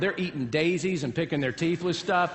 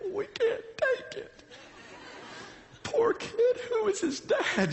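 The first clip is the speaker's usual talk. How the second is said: very high, strained mock wail; imitated parents' outcry; 4 dB quieter, slower than usual. Parents protesting over the crying pitcher, high mock outcry. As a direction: squeal it like an appalled onlooker. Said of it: "every parent on both sides are yelling out".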